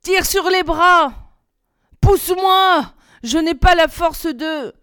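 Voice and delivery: loud, high, effortful calls; straining. A narrator's reading. Climber calls to helper while hauling up, straining. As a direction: heave the words out under physical effort.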